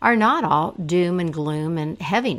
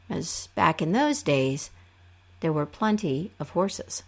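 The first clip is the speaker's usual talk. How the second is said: usual speaking voice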